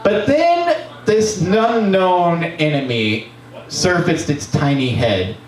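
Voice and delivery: ordinary delivery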